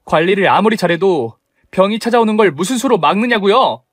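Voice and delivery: daughter-in-law defending her sick father to her mother-in-law; confronting. Acted. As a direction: challenge her point by point.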